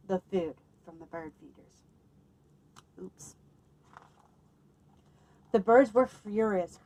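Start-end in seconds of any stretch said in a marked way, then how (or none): none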